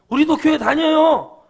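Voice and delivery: high, loud and slow, mocking a proud churchgoer; mock churchgoer boast. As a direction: sneer out the boast like a smug believer.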